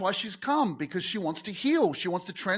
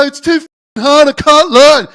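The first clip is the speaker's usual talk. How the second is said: loud, high, despairing wail; despairing cry; 6 semitones higher, 16 dB louder than usual. Retold or imitated. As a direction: cry out that it's all too hard. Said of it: "'cause you go".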